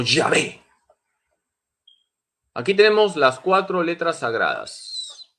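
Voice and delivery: ordinary talk, nothing marked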